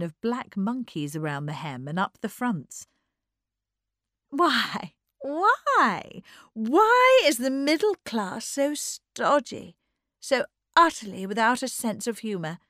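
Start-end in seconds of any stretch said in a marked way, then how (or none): 4.3-12.6 high, loud, drawn-out "why"; theatrical exasperation, leaning hard on each why; exasperated society lady. Acted. Mrs. Norman Knight grumbling about the stodgy middle class, exasperated.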